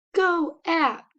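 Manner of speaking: high chanted team cheer; mock crowd chant; imitated band response. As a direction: answer like a band in unison. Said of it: "The band will respond".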